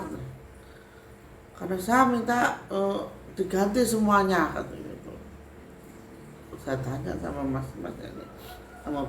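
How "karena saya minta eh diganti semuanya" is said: high, loud bargaining spirit. Retold or imitated. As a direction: demand it like a spirit. Spirit explains its demand.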